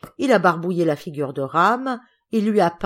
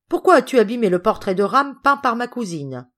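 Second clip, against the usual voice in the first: loud, higher accusing question; acted stern interrogation, voice raised; angry questioning; 5 semitones higher, 6 dB louder than usual. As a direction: demand an explanation from your son. Father angrily questions his son.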